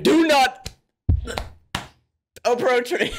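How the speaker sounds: drawn-out hammed-up shriek, breaking off mid-phrase; mock scream. Host imitating the woman warding off a rescuer, mock scream. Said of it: "the woman's just like".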